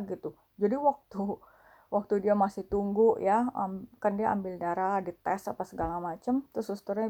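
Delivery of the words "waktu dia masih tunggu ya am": ordinary delivery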